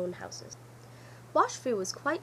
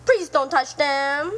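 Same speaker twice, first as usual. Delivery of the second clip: slow, louder, pleading cry; cry of distress; 4 semitones higher, 10 dB louder, slower than usual. Acted. Sing Shu begs the fox to spare his shoes, distressed. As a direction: beg him desperately. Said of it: "cried Sing Shu in great distress".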